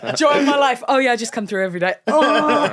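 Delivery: high, loud laughing delight. Real, not acted. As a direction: laugh it out with glee. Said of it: "laughing delight".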